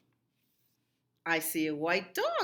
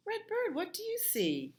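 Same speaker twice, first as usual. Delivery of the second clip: much higher sing-song chant of the refrain. Acted. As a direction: lilt it up high for the children.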